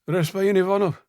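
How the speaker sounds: agitated shout through a door; calling through a heavy door, urgent; muffled through door. A narrator's reading. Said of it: "A muffled voice, agitated".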